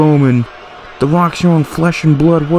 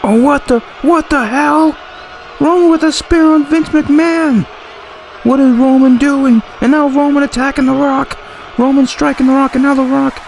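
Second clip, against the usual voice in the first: much higher, stunned play-by-play yelp; shocked outburst; 8 semitones higher than usual. Real, not acted. Commentator reacting to Roman spearing Vince, shocked and high. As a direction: call the sudden attack in disbelief, pitch jumping up.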